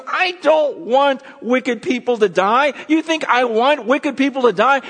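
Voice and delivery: God swearing to his people, loud and high; God pleading. Retold or imitated. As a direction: speak as God, insisting passionately. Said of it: "He goes".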